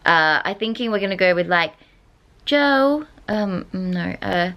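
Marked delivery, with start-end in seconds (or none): 2.4-3.1 high-pitched stab at a Manchester accent; attempted Manchester accent. Shown as a demonstration. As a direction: try out a Mancunian accent, pitched up. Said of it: "a Manchester accent".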